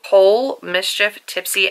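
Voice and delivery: ordinary talk, nothing marked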